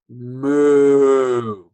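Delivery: loud moo; cow moo. Shown as a demonstration. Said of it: "So a cow goes".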